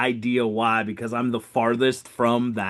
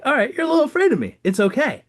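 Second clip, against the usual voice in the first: bright reassurance; 10 semitones higher, 4 dB louder, faster than usual. Retold or imitated. Soothing a scared old lady.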